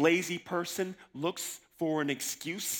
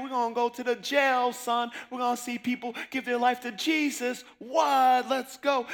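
hyped up; 9 semitones higher, 5 dB louder than usual. Retold or imitated. Quoting friend hyped for jail.

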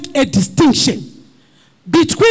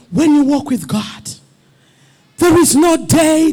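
ordinary delivery

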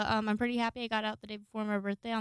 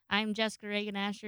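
usual speaking voice

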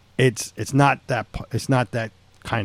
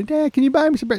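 much higher-pitched imitation of a daughter; mock daughter voice, way up high; pleading daughter; faster than usual. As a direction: put on a high kid voice asking dad. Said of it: "I can imagine my daughter".

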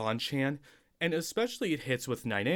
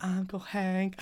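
higher, sing-song Luann from King of the Hill; 8 semitones higher than usual. Pretend. Co-host doing Luann from King of the Hill. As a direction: play a sweet Southern niece calling her uncle. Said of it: "Luann from, King of the Hill".

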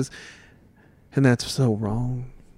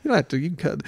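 ordinary talk

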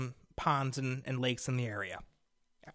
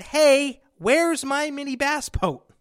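high, loud and slow, demanding; hammed-up irritated call to the store; retold angry demand; 9 semitones higher, 5 dB louder, slower than usual. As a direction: caller demanding his late boat. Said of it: "And I said".

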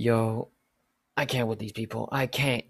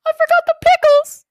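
very high, very loud squeal; hammed-up scream of fake horror; mock shriek; 18 dB louder than usual. Cashier parroting pickle complaint, mocking shriek.

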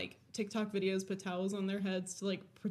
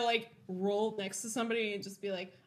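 ordinary talk

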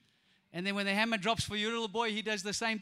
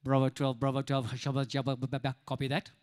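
much lower, radio-operator gabble; police radio voice; 10 semitones lower than usual. Retold or imitated. Imitating police on the radio, low gabble. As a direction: rattle it off like a cop on the radio. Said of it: "they're like".

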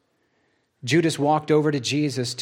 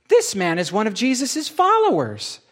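accusing; 8 semitones higher, 4 dB louder than usual. Acted. Servant girl accuses Peter.

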